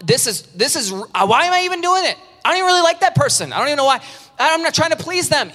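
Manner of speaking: high, fast, fed-up rant; exasperated. Retold or imitated. Preacher imitating a worn-out person questioning the party, high fed-up rant. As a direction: vent like someone at the end of their rope. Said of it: "exasperated inner voice".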